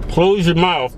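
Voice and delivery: loud command to shut his mouth; loud order. Real, not acted. Ordering his cohost to close his mouth, loudly.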